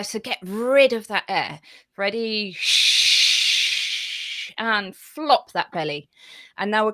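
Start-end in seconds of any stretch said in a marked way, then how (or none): 2.6-4.5 a steady whispered shh pushing the air out; long shush. Shown as a demonstration. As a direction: shush it all out from the belly. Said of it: "I'd like you to shush out".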